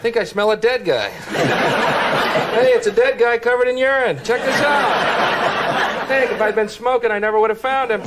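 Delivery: high-pitched, hammed-up amazement; mock excited discovery. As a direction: call people over to a thrilling find. Acts out finding a body by smell.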